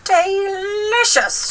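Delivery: very high and slow drawn-out cackle-like crone; old hag tasting slugs, high and slow; gloating delicious. A narrator's reading. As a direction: stretch it out high and slow like a witch. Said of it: "she said again".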